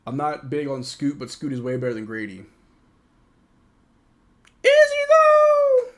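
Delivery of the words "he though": high squeak. Real, not acted. Sudden doubting squeak.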